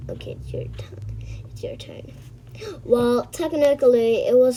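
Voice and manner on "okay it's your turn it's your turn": unvoiced whispered prompt. Whispering to the other kid, it's your turn. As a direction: breathe it out as a secret cue.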